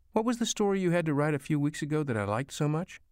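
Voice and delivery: a hint of mother prompting her son; lightly voiced mother. A narrator's reading. Mother prompts son about story.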